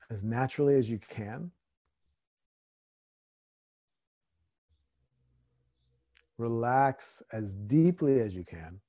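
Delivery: slow, calm meditation guide; guided meditation. Acted. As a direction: ease them into stillness. Leads a meditation.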